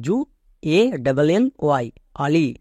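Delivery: ordinary talk, nothing marked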